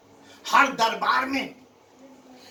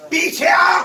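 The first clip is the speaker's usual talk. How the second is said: much louder and higher order; commanding shout; 7 semitones higher, 9 dB louder than usual. Acted. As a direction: bark the order at the officer. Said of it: "بھائی کیا کہہ رہا ہوں". (گرج کر حکم)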